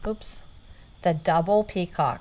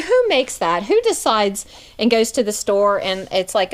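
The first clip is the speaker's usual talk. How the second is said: ordinary delivery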